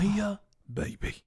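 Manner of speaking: cockney "Mia Bibi" in a Michael Caine voice; Michael Caine impression. Host doing the name as Michael Caine, cockney. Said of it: "Michael Caine".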